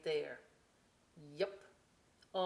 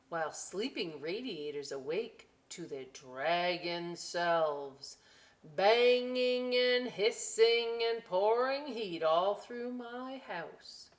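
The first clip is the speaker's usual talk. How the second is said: put-on slow, lively read-aloud to children; storybook voice, slow and bright; story reading; 2 semitones higher, 7 dB louder, slower than usual. A narrator's reading. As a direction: savour each line for the kids. Reading book.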